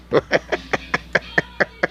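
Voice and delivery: silent wheezing laugh; breathy laugh. Real, not acted. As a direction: laugh without voice. Laughing at own joke.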